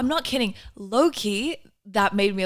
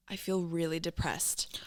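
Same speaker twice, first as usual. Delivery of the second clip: whisper; 2 semitones lower, 8 dB quieter than usual. Retold or imitated. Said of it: "I was like".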